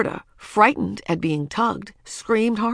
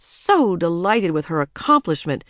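usual speaking voice

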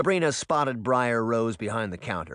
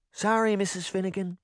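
apologetic princess; 8 semitones higher than usual. A narrator's reading. Princess apologizes to impatient customer.

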